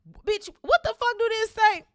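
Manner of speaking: high, loud, slow exclamation; exasperated outburst. Real, not acted. Reader stumbling over the text, loudly exasperated. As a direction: break off and yell in bafflement.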